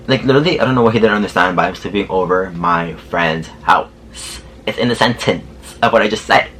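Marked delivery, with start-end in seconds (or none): 1.6-3.9 exasperated, spelled-out slowness, lower pitch; slow over-enunciation. Retold or imitated. Repeating it for a driver who didn't understand, slow and emphatic. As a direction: say it word by word as if to someone slow.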